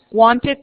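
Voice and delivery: wanted stressed with a rising "id" ending. Shown as a demonstration. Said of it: "su pronunciación será it".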